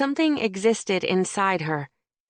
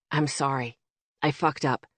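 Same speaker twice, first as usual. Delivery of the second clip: sincere apology; 4 semitones lower, 3 dB quieter than usual. Acted. Oliver apologizing over the phone.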